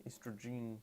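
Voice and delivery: "Estrogen" murmured softly with a long -jeen ending; misspelling tried out. Shown as a demonstration. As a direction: softly test "Estrogen" with its end like "jean". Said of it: "spelled estrogen wrong".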